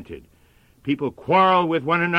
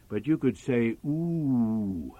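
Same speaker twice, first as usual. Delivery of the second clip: put-on a hushed, gentle little-girl voice, softer; softly voiced little girl; 3 semitones lower, 7 dB quieter, slower than usual. Little girl gently asking her busy father for sympathy, softly. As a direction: coax him softly like a small child. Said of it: "The little girl said".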